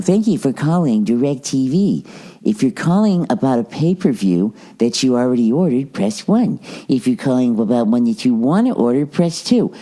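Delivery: sing-song phone menu recording; automated phone robot. Retold or imitated. Phone system greeting a caller, in a canned robot lilt. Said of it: "I get into that fucking nightmare".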